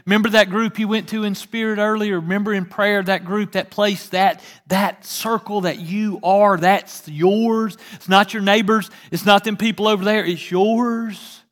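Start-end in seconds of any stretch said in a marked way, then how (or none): none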